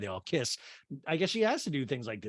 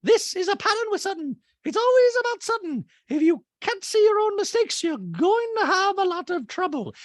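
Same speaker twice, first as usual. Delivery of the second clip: piercingly high, loud mock lecture; Dorit impersonation; 5 dB louder than usual. Host mimics housewife judging another's pattern, high loud lecturing. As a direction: lay down judgement in a high caricature. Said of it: "Dorit in a talking head goes".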